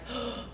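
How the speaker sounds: sharp frightened intake of breath; panicked gasp. Retold or imitated. Recalling the rejection, a sudden panicked gasp. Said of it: "the panic setting".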